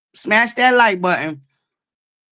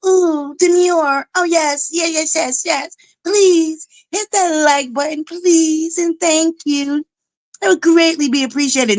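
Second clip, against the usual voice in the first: prissy, higher, drawn-out politeness; mock demure; 6 semitones higher, slower than usual. Streamer mocks prim manners to chat, high mock demure. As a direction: play the dainty lady, lightly mocking. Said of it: "acting like I'm unhuman and I'm so".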